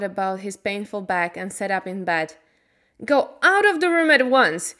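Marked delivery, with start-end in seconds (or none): 3.0-4.7 high, loud furious order; angry shout. A narrator's reading. Colin ordering Mary out, angry shout. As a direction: shout her out of the room. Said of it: "he shouted and threw a book".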